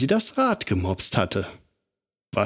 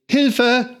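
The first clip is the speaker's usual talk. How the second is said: very high, louder cry for help; screamed for help; 12 semitones higher, 4 dB louder than usual. A narrator's reading. Lotta on the runaway bike screams for help. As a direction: shriek it as you crash down the hill. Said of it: "schrie Lotta".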